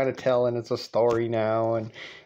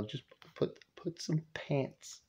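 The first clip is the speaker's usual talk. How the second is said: soft, embarrassed urging under the breath; imitated son quietly talking his mother down; hushed pleading; 9 dB quieter than usual. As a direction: plead discreetly, dropping almost to nothing. Dad pleads discreetly with half-dressed mother.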